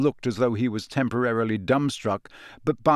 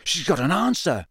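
excited; 5 semitones higher than usual. Acted. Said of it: "said Ron excitedly".

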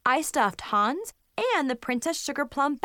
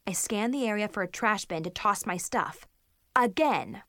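ordinary delivery

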